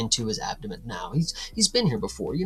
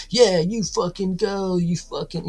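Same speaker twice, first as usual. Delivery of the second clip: tough guy; 6 semitones higher than usual. Acted. Stabbed guy pulling the knife out, mock tough-guy taunt. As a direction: put on a pumped-up tough guy, drawn out. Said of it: "that's what a tough guy does".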